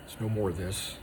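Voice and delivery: ordinary talk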